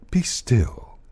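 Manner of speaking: breathy half-whisper; hushed reassurance. Acted. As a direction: hush the listener in a near whisper. Tree hushes the stricken listener.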